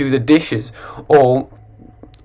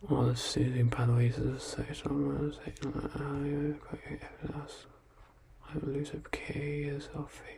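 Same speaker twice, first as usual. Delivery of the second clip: whispered read; 18 dB quieter than usual. Real, not acted. Reading garbled joke softly.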